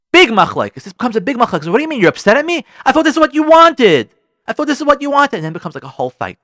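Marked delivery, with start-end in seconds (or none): 1.7-5.4 high, loud protest from hurt husband; heated, raised-voice self-defence; defensive quarrel. Acted. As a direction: argue back, indignant at the blame. Husband in acted marital fight.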